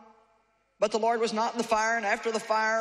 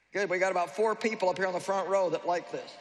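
ordinary delivery

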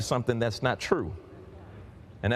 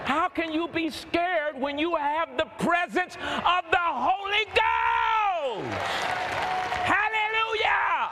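high, slow preacher's shout with long-held final words; full-force preaching; slower than usual. Real, not acted. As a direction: cry it out to rouse the congregation. Preacher cries out to congregation.

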